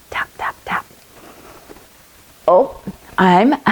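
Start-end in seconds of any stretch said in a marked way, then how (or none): 0.1-0.9 soft whispered magic taps. Pretend. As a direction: whisper the spell.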